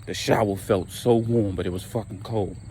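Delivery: ordinary talk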